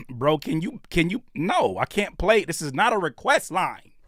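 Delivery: normal talking voice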